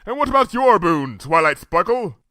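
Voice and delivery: high, grand royal question; a princess offering a reward; Luna's regal voice. A narrator's reading. Luna offering Twilight a boon, regal.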